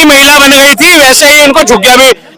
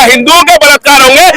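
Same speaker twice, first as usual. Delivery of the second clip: ordinary talk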